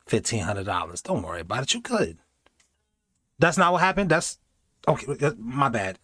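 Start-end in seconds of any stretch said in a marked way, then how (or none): 0.0-2.2 soft, low sweet-talk; coaxing. Retold or imitated. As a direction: lure them to stay quietly. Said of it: "That was them saying".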